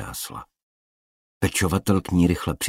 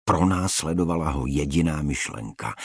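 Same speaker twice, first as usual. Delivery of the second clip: ordinary delivery, nothing marked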